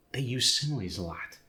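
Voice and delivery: ordinary talk, nothing marked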